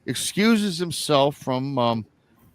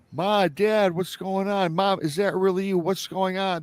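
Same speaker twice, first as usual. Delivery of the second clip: high-pitched, imitated pleading questions; frantic; 8 semitones higher than usual. Brother near tears calls to his parents, frantic. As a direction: act out a frantic plea, pitched well up.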